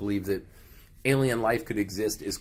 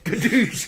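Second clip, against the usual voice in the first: gun sound; 11 semitones higher, 3 dB louder than usual. Pretend. Imitating a shotgun blast.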